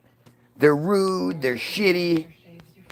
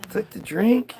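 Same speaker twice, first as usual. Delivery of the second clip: ordinary talk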